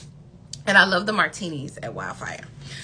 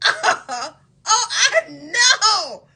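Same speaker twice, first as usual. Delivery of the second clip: high, slow words through laughter; laughing disbelief; 10 semitones higher, 3 dB louder, much slower than usual. Retold or imitated. Reacting to the date's missing wallet, laughing in disbelief.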